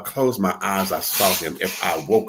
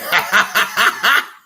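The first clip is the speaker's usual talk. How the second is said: big laugh; 12 semitones higher, 7 dB louder than usual. Real, not acted. Laughing at her story.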